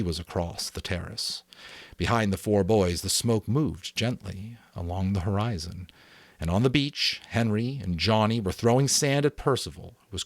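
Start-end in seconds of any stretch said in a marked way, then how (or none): none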